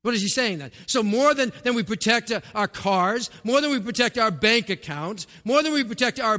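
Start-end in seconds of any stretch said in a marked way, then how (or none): none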